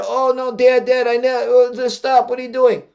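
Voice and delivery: high, mock-frantic protest; retold panicked plea. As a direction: act out a kid begging Dad to stop. Retells frantic teenage plea to dad.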